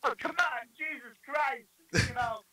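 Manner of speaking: high Brooklyn tough-guy voice; Tony Manero impression. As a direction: do a cocky Brooklyn Travolta. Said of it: "Let's hear it".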